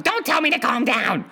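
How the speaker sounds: imitating an upset girlfriend's retort; mock angry snap. Girlfriend snapping back at boyfriend, mock angry. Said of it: "she's screaming at me".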